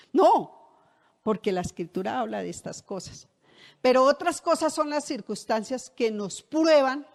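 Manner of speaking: usual speaking voice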